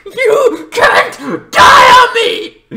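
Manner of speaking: high, loud screaming with half-laughing sobs; hammed-up anguished yelling, slowing at the end; grief-stricken shout. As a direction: scream it over your dying best friend. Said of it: "this is when he snaps".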